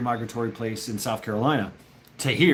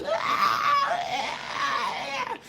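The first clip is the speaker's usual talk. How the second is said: high, long imitated shriek; red fox scream; 13 semitones higher than usual. Showing how chilling a red fox sounds, imitated scream. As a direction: mimic a child-like animal scream. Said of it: "the scream of a red fox".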